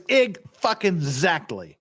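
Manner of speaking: ordinary talk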